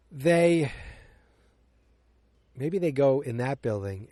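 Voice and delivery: normal talking voice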